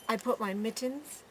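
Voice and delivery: ordinary delivery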